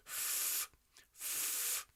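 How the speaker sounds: unvoiced "F" blown as a tube of air; f airflow. Shown as a demonstration. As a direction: push air through "f" with no voice. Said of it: "is to go like this".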